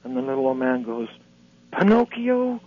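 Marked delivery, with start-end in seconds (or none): 1.7-2.6 Pinocchio? pitched way up in delight; surprised cry. Acted. Old man answers Jesus with a high, delighted cry. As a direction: fling the punchline out high. Old man's punchline reply.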